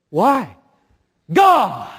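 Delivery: booming defiant shout; shouted outcry. Shown as a demonstration. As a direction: cry out to God at full voice. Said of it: "I say it like that".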